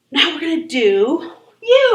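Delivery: usual speaking voice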